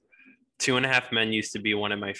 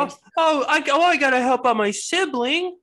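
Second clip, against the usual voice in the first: put-on much higher, breathy girlish voice; high sister voice; 6 dB louder than usual. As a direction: play the eager sister, way up high. Acting the TV sister.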